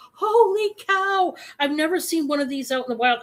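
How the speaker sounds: thrilled find at a thrift sale, gushing; giddy excitement. Retold or imitated. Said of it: "I get all excited like this".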